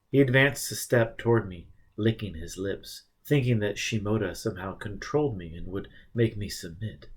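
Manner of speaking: ordinary delivery, nothing marked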